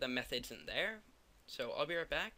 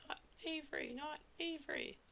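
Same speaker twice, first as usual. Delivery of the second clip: squeaky high voice; 13 semitones higher, 5 dB quieter than usual. Pretend. Teasing answer to his own question.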